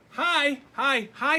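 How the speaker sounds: high, loud eager hi; boy calling brightly into the basement; excited little boy greeting. Acted. Little Laszlo calling cheerfully down to the dark in the basement.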